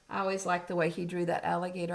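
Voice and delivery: ordinary delivery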